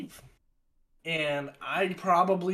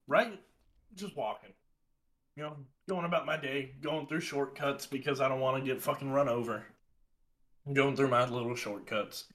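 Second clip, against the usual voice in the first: ordinary talk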